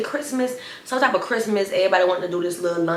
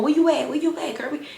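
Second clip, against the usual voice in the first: high calling voice; 9 semitones higher than usual. Retold or imitated. Classmates calling on the phone.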